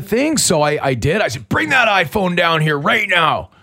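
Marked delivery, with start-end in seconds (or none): 1.5-3.5 slow, raised, stern command; dad voice. Retold or imitated. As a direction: order a kid down like a fed-up dad. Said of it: "This is my dad voice".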